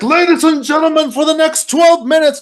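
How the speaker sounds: high-pitched mock stage announcer. Addressing the Super Bowl crowd, hyped-up showman. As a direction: imitate a rock frontman addressing a stadium. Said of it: "he's like".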